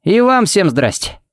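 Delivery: cheery high-pitched hello; delighted greeting. Acted. Pharmacist welcoming the whole group, delighted and high. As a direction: toss a glad hello to everyone, voice lifted. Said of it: "обрадовался он".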